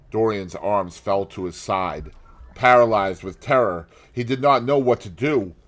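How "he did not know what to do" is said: ordinary delivery, nothing marked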